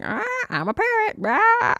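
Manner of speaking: very high squawking parrot. Pretend. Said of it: "more like a dying parrot".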